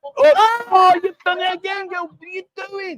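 high, loud real dismay; exasperated outcry. Real, not acted. Host scolding a girl who spilled drink, exasperated. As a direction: cry out in high-pitched exasperation.

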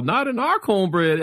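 high, slow mock chorus; group catchphrase. Storyteller mimics everyone backstage repeating the bit, high catchphrase. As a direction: stretch it out like a running joke. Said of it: "Like, we're all".

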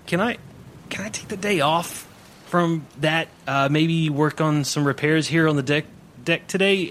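high, nervous, pleading voice; timid pleading sailor. Acted. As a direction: beg meekly to skip the job.

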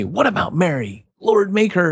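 high, whiny complaint; lightly voiced fussy Martha; complaining Martha. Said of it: "Martha's all busy, busy".

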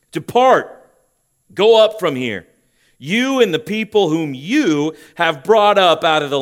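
loud, high command, stressing Depart; God banishing the people, loud and stern. Acted. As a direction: order them away with divine anger. Preacher voices God sending them away.

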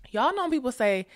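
ordinary talk, nothing marked

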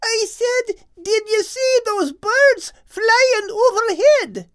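high, loud, slow shout. Acted. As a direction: yell across the mountain pass. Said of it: "shouted Kate in reply".

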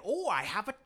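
lightly put-on European accent; a hint of a sinister showman accent; Tom Hanks accent. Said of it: "he has an accent".

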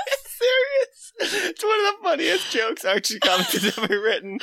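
laughing. Real, not acted. Cracking up at the joke.

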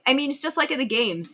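normal talking voice

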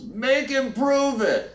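high slow shouted dare; shouted challenge. Retold or imitated. Someone in the crowd shouting a high slow dare. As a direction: call out the dare slowly. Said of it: "someone else says".

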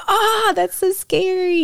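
high, slow frightened squeal; mock scared. Retelling her reaction to the ghost voice, high and frightened. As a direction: squeal like she's spooked by the ghost video. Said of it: "I was like".

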